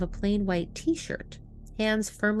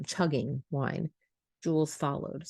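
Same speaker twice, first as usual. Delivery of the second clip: ordinary delivery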